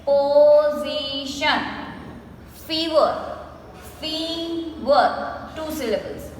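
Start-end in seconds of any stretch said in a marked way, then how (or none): 0.0-1.6 "Position" drawn out as po-zi-shun; three long, even beats on "Position"; broken into syllables. Shown as a demonstration. Teacher answering how we break it, "Position" slowly syllable by syllable. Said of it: "So, how do we break it?". 4.0-5.5 "Fever" split as fee... ver; two separated beats on "Fever"; slowly broken up. Shown as a demonstration. Teacher counting two syllables, "Fever" slowly broken up. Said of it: "we say it slowly and we break the word".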